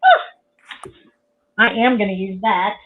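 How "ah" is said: delighted squeal. Real, not acted. Seeing the gift.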